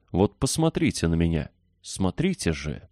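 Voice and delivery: plain reading voice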